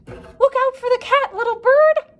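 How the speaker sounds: very high, loud duck cry; quacked warning. A narrator's reading. Duck warning the bird about the cat, high loud cry. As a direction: screech the warning. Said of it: "quacked the duck".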